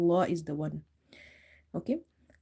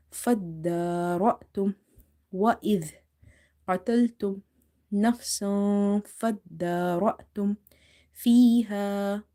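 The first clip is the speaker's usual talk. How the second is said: Quran recitation. Acted. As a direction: recite the verse with care. Reciting the verse.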